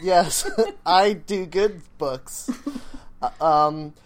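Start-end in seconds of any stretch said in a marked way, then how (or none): 0.0-2.5 laughing. Pretend. Playing along with alien talk.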